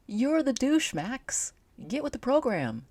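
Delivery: high, loud mocking jab; cocky character taunt. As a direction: sneer it up high. Max taunts Rakesh.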